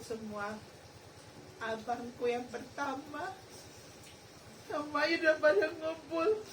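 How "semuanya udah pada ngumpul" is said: tearful. Real, not acted. Tearful testimony of family.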